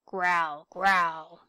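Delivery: teddy showing how to growl; growly bear noise. Acted. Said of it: "a growly noise".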